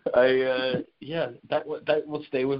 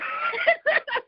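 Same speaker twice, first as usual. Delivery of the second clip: high burst of laughter. Real, not acted. Laughing at own story.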